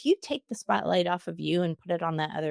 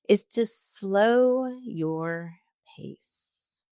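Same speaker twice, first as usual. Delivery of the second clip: very slow, drawn out, with a break before pace; 2 semitones higher, much slower than usual. Shown as a demonstration. Coach showing listeners how to sound confident with the boss, slowed pace. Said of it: "when I slowed my pace just now".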